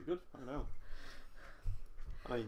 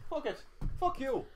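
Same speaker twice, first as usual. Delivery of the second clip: higher-pitched mock Irish cursing; Irish accent; 7 semitones higher than usual. Shown as a demonstration. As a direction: curse in a put-on Irish accent. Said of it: "Let's go for the Irish accent".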